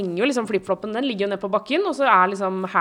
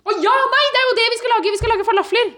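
much higher, bright realisation; suddenly remembers, much higher; excited; 13 semitones higher, 3 dB louder, faster than usual. Real, not acted.

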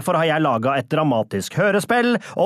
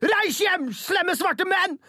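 mock shout; 4 dB quieter, slower than usual. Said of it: "for å rope".

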